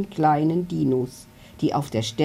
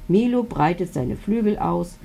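ordinary delivery

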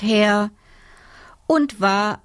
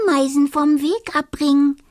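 giggling excitedly; 6 semitones higher, faster than usual. Acted. Dormouse giggles at idea.

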